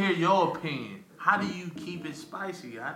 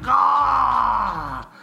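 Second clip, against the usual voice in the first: mock yell; 9 semitones higher, 8 dB louder than usual. Mock shout during retold sex.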